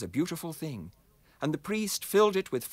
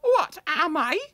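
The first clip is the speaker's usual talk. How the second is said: proud magic kettle badger; 5 dB louder than usual. Badger introduces itself.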